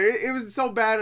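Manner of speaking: normal talking voice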